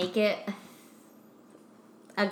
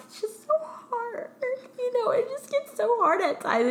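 fake crying; 13 semitones higher than usual. To Sponsor Daddy, high fake tearful whimper. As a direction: pretend to cry to fool him. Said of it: "I'll just sniffle a little bit".

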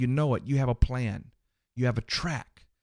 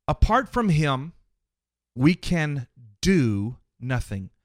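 "do" leaned on, slow and heavy; stress on do; 4 dB louder than usual. Shown as a demonstration. Preacher to congregation, slowly stressing "do". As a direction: land hard on "do" in "we can do nothing". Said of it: "Emphasis on the word do".

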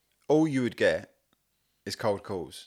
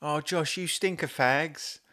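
higher, slow nagging nan; a hint of a scolding grandmother; lightly voiced nan; 7 semitones higher, slower than usual. Doing the host's joke, quoting his nan lightly. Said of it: "My nan says to me".

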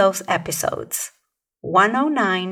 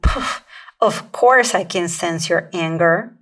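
dismissive "Poof" with a scoff; 3 semitones lower, 3 dB louder than usual. Shown as a demonstration. Coach demonstrates an uncomforting reply, scoffing. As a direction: brush it off like it's obvious. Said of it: "this tone of voice is not going to offer comfort".

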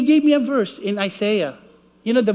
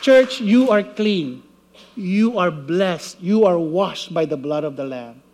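slow, lower preached blessing; solemn declaration; 5 semitones lower than usual. Acted. Preacher declares the church clean, slow solemn blessing. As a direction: pronounce it over them slowly.

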